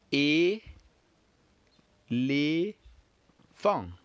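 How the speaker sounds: teacher modelling "e, le, fang" for repeating; each syllable of "e, le, fang" held separately. Shown as a demonstration. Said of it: "let's repeat again together".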